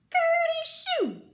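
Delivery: very high, loud stretched-out mock sneeze; big storybook sneeze. A narrator's reading. Peter sneezes while hiding in the tool shed, loud comic sneeze. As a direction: wind up high, then burst out the achoo. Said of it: "Peter sneezed".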